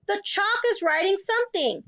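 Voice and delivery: high, sustained excited cry; frantic schoolboy exclamation; screamed. A narrator's reading. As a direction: alert the whole room in astonishment. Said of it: "screamed Nigel".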